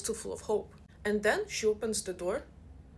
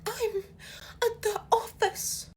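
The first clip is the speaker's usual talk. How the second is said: much higher, slow mock whimper; mock-pitiful; 12 semitones higher, slower than usual. Rich kid tells jet-setting friends, mock-pitiful whimper. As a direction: whimper it like it's tragic. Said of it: "And I'm like".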